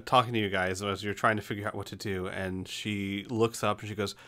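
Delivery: ordinary delivery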